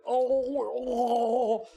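long high groan of dread; hammed-up drawn-out moan; miserable groan. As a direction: groan at another forced drink. Vendor groans over a second bottle.

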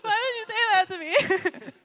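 high, loud wailing cry; hammed-up tearful wail; mock bawling. Mother asked about missing her kids, mock bawling.

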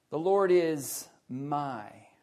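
slow, pause before a drawn-out "my"; stress on MY. Shown as a demonstration. Preacher pointing out the little word, stress on "my". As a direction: set "my" apart and stop on it. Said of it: "it says that little word".

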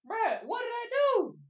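high, loud confused protest; can't believe he's in trouble; indignant disbelief. Retold or imitated. Kid to teacher, high and loud indignant.

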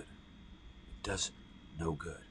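a hushed whispered repeat for weight. Shown as a demonstration. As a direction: drop it to a whisper. Repeated as a near-silent whisper.

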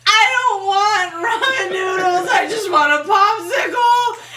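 high, loud, drawn-out mock bawling; hammed-up tearful protest; crying tantrum. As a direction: wail like an inconsolable child. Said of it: "Why am I crying?".

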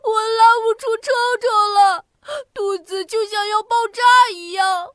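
put-on high, sobbing child wail; panicked tears; crying anxiously. A narrator's reading. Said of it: "彤彤着急地哭了起来". (着急地哭喊)

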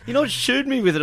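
a hint of the stubborn mate saying no; mate refusing.

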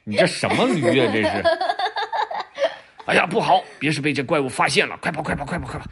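高声惊呼, 连喊快跑; 吓坏了赶紧逃命; 慌张的老虎. Acted. 老虎被驴叫吓跑. (被吓到的老虎惊慌大喊"快跑")

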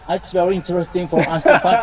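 mock native-speaker English accent; put-on English accent. Mocking learners who imitate natives, put-on English accent. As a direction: overdo the English accent for a laugh. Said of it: "bahasa Inggris nggak usah".

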